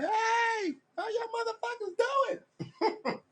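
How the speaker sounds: high-pitched mock scream; shrill-pitched New York street holler. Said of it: "Screaming out".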